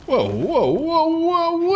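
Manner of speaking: rising, climbing alarmed whoa; startled whoa. Real, not acted. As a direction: cry out as things go wrong. Startled reaction in game.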